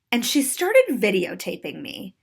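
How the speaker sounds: plain reading voice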